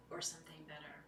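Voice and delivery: whispered refrain, barely voiced. Real, not acted. Echoing the mantra to the circle in a whisper. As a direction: let it out as a hushed breath.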